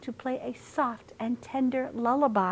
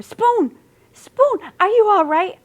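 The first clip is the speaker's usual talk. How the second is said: worried call; 12 semitones higher, 8 dB louder than usual. Acted. Cat rushing to Spoon.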